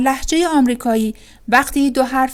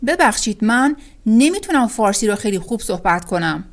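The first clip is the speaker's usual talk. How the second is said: ordinary delivery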